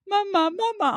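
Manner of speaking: put-on high, insistent child calling mum; high nagging little-kid call. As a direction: mimic a kid pestering through the door. Voicing child calling outside door.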